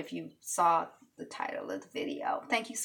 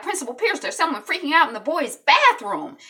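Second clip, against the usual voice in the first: urgent students; 7 semitones higher, 7 dB louder than usual. Retold or imitated. Students report a bathroom emergency.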